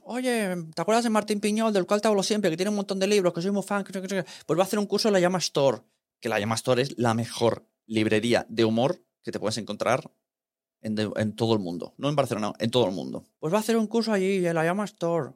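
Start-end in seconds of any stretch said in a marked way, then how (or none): 0.1-5.8 higher-pitched quick retold phone chatter; Carlos. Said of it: "me llamó mi amigo Carlos". 13.4-15.3 retold caller, higher and quick; Carlos. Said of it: "me llamó mi amigo Carlos".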